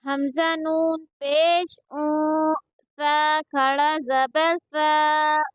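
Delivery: slow, level-pitched syllable chant; measured qaida recitation for learners to copy; Quranic spelling drill. Shown as a demonstration. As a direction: spell out each syllable with drawn-out vowels.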